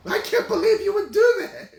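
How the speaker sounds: high, loud mock outrage; indignant woman. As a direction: impersonate her shocked accusation. Woman accusing him.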